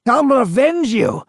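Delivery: high, loud vengeful cry; ghost shouting. A narrator's reading. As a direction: shout the oath of revenge up high. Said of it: "the ghost shouted".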